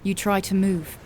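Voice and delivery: ordinary delivery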